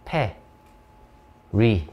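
페, 리 with a pause between; syllable by syllable. Shown as a demonstration. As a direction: sound out 페, then 리, separately. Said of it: "요렇게 발음나게 읽으시면".

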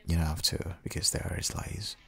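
ordinary talk

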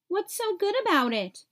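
high, sharp snap; snapping. A narrator's reading. Even Steven snapping at his cousin over the lost pancakes. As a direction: bite the words off in annoyance. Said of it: "Even Steven snapped".

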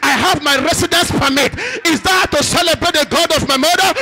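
loud, high, fervent preaching; preaching at full force. Acted. Pastor rousing the church, preaching at full force. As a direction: rouse the congregation with every line. Preacher rallies congregation to praise.

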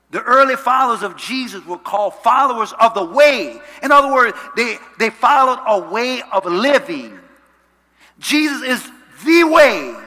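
high, loud sermon delivery with punched key words; full-force preaching. Real, not acted. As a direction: proclaim it to the whole church.

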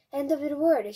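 loud storyteller's mild wail. A narrator's reading. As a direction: cry it out loudly as doom news. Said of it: "she wailed".

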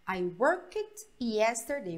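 slow, careful classroom model; slow model sentence. Shown as a demonstration. Teacher models the sentence slowly for the class, slow. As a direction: spell it out slowly for the students. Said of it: "olha essa frase aqui".

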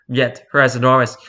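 normal talking voice

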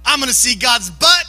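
gung-ho believer, voice lifting at the ends; hammed-up zeal. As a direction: build into over-eager holy bravado. Mimics an overeager new faster.

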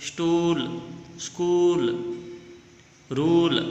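"स्टूल, स्कूल, रूल" with long, held oo. Shown as a demonstration. Teacher says words for pupils to repeat, long oo in "स्टूल, स्कूल, रूल". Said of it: "बड़े ओ की sound आ रहा है".

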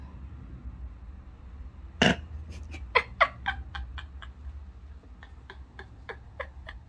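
long, high giggling fit; mocking laughter. Laughing at the pup's bark, high and helpless. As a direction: burst into prolonged high laughter.

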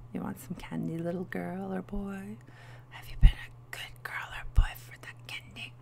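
whispered creepy stranger offer; creepy candy stranger. Pretend. Luring a kid with candy, a mock creepy whisper.

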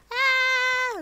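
sudden high loud cry; startled shout. Real, not acted. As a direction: jolt out a startled yell. Sudden zombie horde appears.

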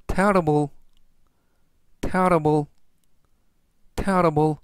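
terrible said clearly three times; teacher modelling terrible; careful pronunciation demo. Shown as a demonstration. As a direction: model the word terrible for learners. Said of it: "이라고 발음하시면 되겠고요".

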